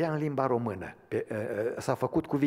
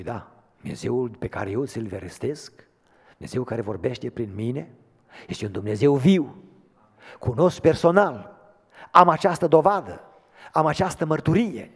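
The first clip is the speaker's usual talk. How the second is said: starts level, builds to a loud ringing proclamation; preaching, building up; 2 semitones higher, 11 dB louder than usual. Acted. Believer testifying about a living God, building to loud preaching. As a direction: swell into a triumphant declaration of faith.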